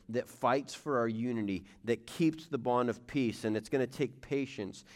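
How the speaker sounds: usual speaking voice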